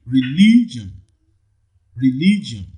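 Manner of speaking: religion with a long, strong LI; stress on second syllable. Shown as a demonstration. Teacher models option B, religion stressed on LI. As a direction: stretch the second syllable of religion, then fall. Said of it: "the second syllable".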